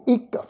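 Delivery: ਇੱਕ with short i and final k; pronunciation demo. Shown as a demonstration. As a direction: model ਇੱਕ as its own word. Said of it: "ਇਸ ਦਾ ਜਿਹੜਾ ਉਚਾਰਨ ਹੈ ਉਹ ਅਸੀਂ ਕਰਾਂਗੇ". (ਇੱਕ ਦਾ ਵੱਖਰਾ ਉਚਾਰਨ)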